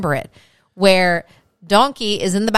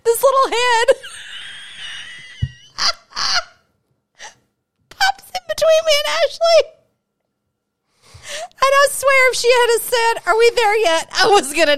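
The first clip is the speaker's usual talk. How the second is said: laughing. Real, not acted. Laughing while telling the story.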